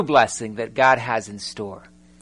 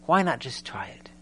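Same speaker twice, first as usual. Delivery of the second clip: softer, whispered invitation; 2 semitones lower, 9 dB quieter than usual. Acted. Preacher urging listeners to simply wash: whispered. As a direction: breathe it like a gentle dare.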